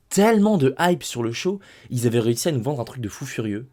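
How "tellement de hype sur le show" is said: much higher, thrilled; excited. Real, not acted. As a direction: gush about the hype. Hyped about the show. (très excité, voix plus aiguë)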